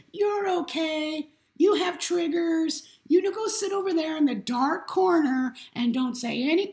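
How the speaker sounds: weak, coddling voice. Acted. Mocking those who sugarcoat and say you're okay, high weak voice. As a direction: put on a high, feeble voice of someone coddling. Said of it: "I'm equating this voice to weakness".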